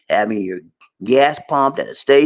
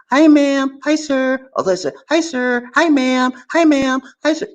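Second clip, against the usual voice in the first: high, bright-voiced clerk greeting, much higher; imitating shop staff; 12 semitones higher than usual. Store clerk greeting a foreign customer, high and sunny. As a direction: chirp it like a cheerful store clerk. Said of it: "they gonna say".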